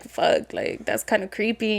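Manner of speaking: low, soft, creeped-out reaction; acted hushed unease; uneasy. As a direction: drop the voice, quietly unsettled.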